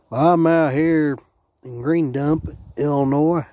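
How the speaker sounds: ordinary talk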